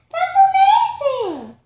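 high, loud excited exclamation; amazed cry. A narrator's reading. Cherry watches the witch fly, crying out in high amazement. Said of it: "cried Cherry".

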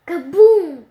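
loud thunder boom; booming kaboom. A narrator's reading. Narrator booms out the thunder, loud. Thunder sound effect word.

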